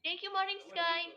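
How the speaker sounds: bright, loud shout-out of thanks; loud thank-you. Real, not acted. Streamer loudly thanking a gifter, loud shout. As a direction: call the thanks out high and loud.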